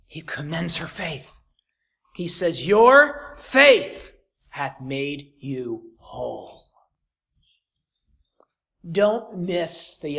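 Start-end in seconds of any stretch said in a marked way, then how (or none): 2.7-6.6 very slow, low, weighty pronouncement. Acted. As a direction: deliver it like a blessing. Jesus pronounces her healed.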